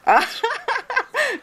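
sudden high-pitched laugh; high burst of laughter. Real, not acted. Host cracks up at French idea.

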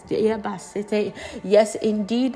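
ordinary talk, nothing marked

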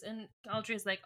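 ordinary talk, nothing marked

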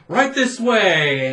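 loud, slow eerie invitation; mock ghostly host; spooky host. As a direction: draw it out like a haunted butler.

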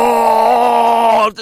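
high, loud mock rock-star whoa; drunk rock yell. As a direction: belt it like a wasted sound man into the mic. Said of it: "just going".

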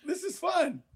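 talking while bouncing around the screen; spinning in office chair. Real, not acted. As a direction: voice jumping around while moving. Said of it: "It's like leapfrogging back and forth".

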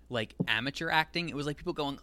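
normal talking voice

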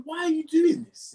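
high, drawn-out, incredulous why; exasperated protest. Retold or imitated. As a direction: retell a baffled protest to a teacher. Retold argument with teacher.

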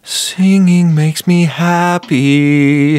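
high, very slow cheerful voice; goofy elf voice. Acted. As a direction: beam it out like Buddy the elf. Elf quote voice.

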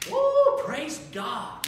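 a quick high woo, then back down; imitating a joyful whoop; mock hoop and holler. Congregation cheering a baptism, light mock whoop. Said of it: "some people hoop and holler".

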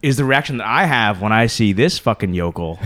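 ordinary delivery

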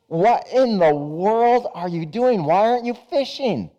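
a rich man puzzling at a lazy fisherman; incredulous entrepreneur. Acted. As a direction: pastor playing the rich man scolding the idle fisherman.

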